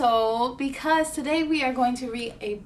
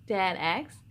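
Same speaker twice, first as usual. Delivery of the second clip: usual speaking voice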